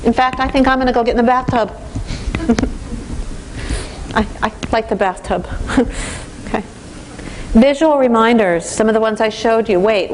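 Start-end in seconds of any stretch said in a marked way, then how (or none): 1.8-4.1 quiet, unvoiced chuckle; soft breathy laugh. Real, not acted. Joke about the bathtub. 5.5-6.4 quiet laugh. Real, not acted. Joke about the bathtub.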